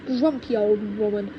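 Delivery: ordinary delivery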